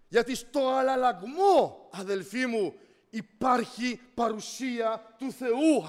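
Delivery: high, loud, shouted preaching; full-force exhortation. Real, not acted. Preacher urging people to cry out to the Lord, shouting. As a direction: urge them to shout, stretching the key words.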